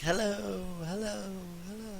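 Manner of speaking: high echo fading to quiet; cave echo. Shown as a demonstration. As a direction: imitate the cave echoing back. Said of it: "And it's like".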